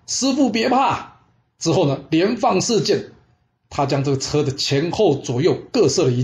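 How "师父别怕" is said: high, slow, drawn-out call. Acted. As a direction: call out to your master from a distance, pitched way up. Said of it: "然后大声喊着说". (拉长声音高声喊话)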